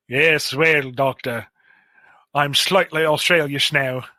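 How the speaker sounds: slightly Australian, drifting toward Cockney; shaky Australian accent. Acted. Revived Flatline to the doctor, amateur Australian accent. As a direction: attempt a light Australian accent. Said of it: "I can't do a good Australian accent".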